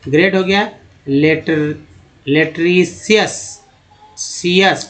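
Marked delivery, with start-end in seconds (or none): 2.3-3.6 लेटरीसियस said very slowly, syllable by syllable; slow careful pronunciation. Shown as a demonstration. Teacher to students, slow pronunciation demo. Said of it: "सा बोलते हैं".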